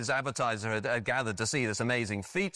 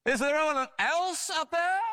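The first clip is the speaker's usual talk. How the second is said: shouted again; 13 semitones higher, 4 dB louder than usual. Retold or imitated. Doubting man on the branch shouting for another rescuer, high-pitched. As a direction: shout upward, leaning on "else". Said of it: "the man shouted again".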